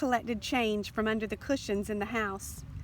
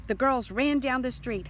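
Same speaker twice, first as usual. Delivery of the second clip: ordinary delivery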